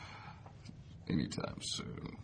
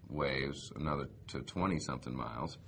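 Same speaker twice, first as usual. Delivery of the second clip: ordinary talk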